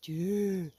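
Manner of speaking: low, soft droning sound; lightly mimicked sluggish man. Mimicking the sluggish neighbour, low and slow. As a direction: drone it low and sleepy. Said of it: "un mec qui fait".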